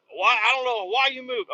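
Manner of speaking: high, baffled voice; mock exasperated worker. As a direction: play the confused worker. Worker questioning driver.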